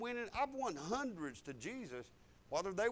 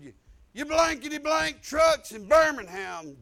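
loud, higher mock cursing tirade; cussing them out; 7 semitones higher, 15 dB louder than usual. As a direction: act out an angry phone rant with censored swearing. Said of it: "I cussing them".

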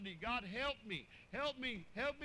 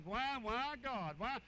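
mock whining; slower than usual.

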